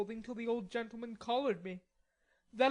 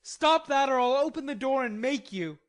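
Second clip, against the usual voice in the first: loud, much higher angry yell; threatening shout; 7 semitones higher, 8 dB louder than usual. A narrator's reading. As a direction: bark it through the door. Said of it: "in a threatening tone".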